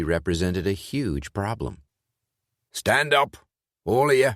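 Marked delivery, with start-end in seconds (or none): 2.7-4.3 put-on commanding Welsh accent, louder. A narrator's reading. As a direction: bark the order in a Welsh accent. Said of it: "he ordered in what Sean was certain was a Welsh accent".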